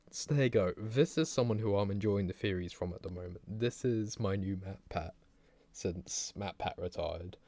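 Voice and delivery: ordinary delivery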